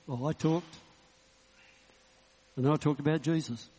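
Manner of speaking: ordinary talk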